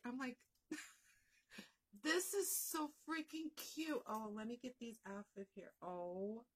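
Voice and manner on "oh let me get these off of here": usual speaking voice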